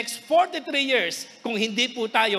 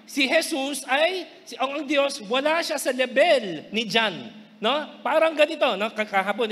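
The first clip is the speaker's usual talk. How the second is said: ordinary talk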